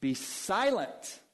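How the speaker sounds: stern, slow command; Jesus' command. Acted. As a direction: say it like a firm rebuke. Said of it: "he says".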